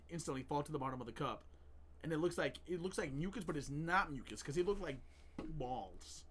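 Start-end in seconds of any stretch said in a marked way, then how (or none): 5.6-6.2 "balls" set apart after a pause, lower and softer; emphasis on balls. Real, not acted. Telling a story about green balls, "balls" emphasized after a pause. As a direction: isolate the word "balls" with weight. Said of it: "Why did you have to give an emphasis on that word?".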